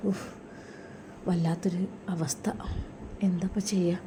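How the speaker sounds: breathless whispered despair. Acted. Mother whispers her distress at son-in-law's bed, whispered. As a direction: whisper it to yourself, at a loss.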